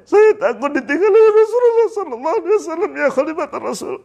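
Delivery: very high, wailing confession; acted sobbing dread, voice strained upward; crying in fear. Bilal telling the caliph he fears abandonment, wailing. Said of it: "mengapa kamu menangis sampai seperti ini".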